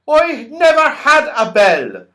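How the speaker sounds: high, loud puzzled voice; deaf Saucepan Man. A narrator's reading. Deaf Saucepan Man mishearing Franny, high and loud. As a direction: mishear and sound baffled. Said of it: "said the Saucepan Man".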